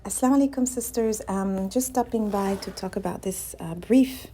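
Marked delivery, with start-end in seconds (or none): none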